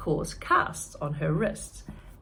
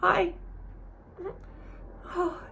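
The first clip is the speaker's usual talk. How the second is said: moved to tears; 13 semitones higher than usual. Acted. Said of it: "Tears welled up".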